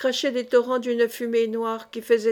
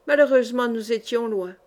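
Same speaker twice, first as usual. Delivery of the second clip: usual speaking voice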